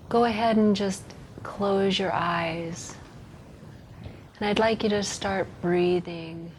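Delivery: soft, hushed meditation voice with long pauses; guided meditation. Acted. Leading listeners into a visualized walk on a rock path, soft guided meditation. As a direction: guide them gently, softly, leaving room to breathe.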